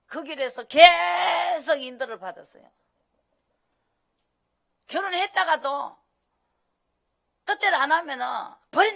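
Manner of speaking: loud, high sermon push; preaching at full force. Acted. Preacher warning the congregation, loud and high. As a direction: drive the point home loudly.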